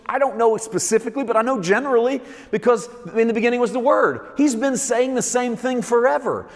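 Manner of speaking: preacher crying out to the congregation; preaching at full force. Acted. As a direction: build it into a roaring sermon climax.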